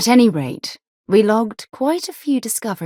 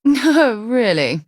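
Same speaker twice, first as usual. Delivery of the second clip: amused disbelief with a laugh; 6 semitones higher, 5 dB louder, slower than usual. Acted. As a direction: laugh through the words, high and bright. Laughs at a remark.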